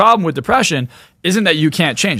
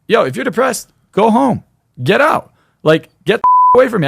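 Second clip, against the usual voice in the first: raised, higher voice, telling them to get lost; loud impatient dismissal; blunt loud brush-off; 7 semitones higher, 4 dB louder than usual. Retold or imitated. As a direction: shove them out the door with your voice. Telling depressed people to leave.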